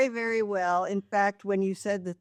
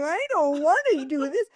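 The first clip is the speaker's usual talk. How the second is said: high, nasal-free whine, higher pitched; whiny SNL whiner; 8 semitones higher, faster than usual. Retold or imitated. Mother imitating the SNL whiners, high whine. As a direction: whine it like a sulky kid. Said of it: "skit on Saturday Night Live of the whiners".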